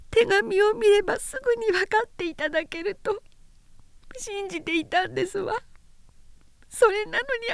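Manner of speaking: high, soft, sobbing confession. Acted. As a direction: weep the words out. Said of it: "むせび泣きながら言った".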